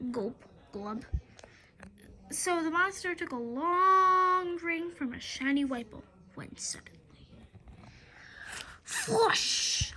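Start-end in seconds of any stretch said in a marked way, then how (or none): 0.1-1.1 soft low gulping noises; gulping sound. Acted. Monster drinking sound. 5.9-7.0 whispered, quick build-up; suspense whisper. A narrator's reading. Suspense before the flush. 8.8-9.9 imitated toilet flush; flush sound.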